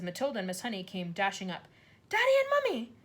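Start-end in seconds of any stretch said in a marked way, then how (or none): 2.1-2.9 put-on much higher, loud breathless child; a girl calling her parents at the car; bursting out. A narrator's reading. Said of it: "Matilda burst out".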